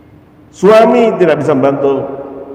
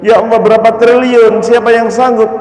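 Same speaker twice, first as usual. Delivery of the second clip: astonished exclaim; 11 semitones higher, 4 dB louder than usual. Acted. Man astonished at price.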